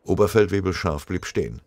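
ordinary delivery, nothing marked

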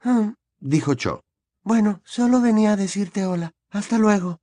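put-on flustered timid girl; shy girl voice. A narrator's reading. Cho voiced shyly.

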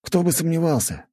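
understated scoff in a high friend's voice; wry scoff. A narrator's reading. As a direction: toss it back high-pitched, lightly scoffing. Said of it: "хмыкнул он в ответ". (лёгкая усмешка высоким голосом)

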